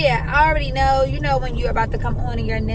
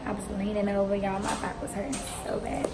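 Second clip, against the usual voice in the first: hushed talk; 2 semitones lower, 12 dB quieter than usual. Real, not acted. Waiting room, talking softly.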